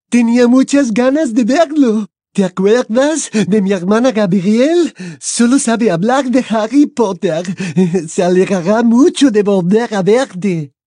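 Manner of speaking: put-on a delighted French girl; French accent.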